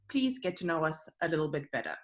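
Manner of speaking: ordinary talk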